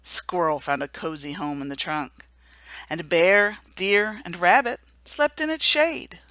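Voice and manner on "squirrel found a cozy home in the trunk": ordinary delivery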